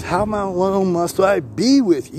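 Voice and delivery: high, slow, exasperated plea, pitched well up; mock frustrated sigh of a question; frustrated Jesus. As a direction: drag it out like a fed-up teacher. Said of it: "He was frustrated too".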